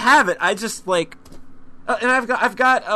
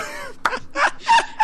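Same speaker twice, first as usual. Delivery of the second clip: shrieking laugh. Real, not acted. Reacting to fart piano app.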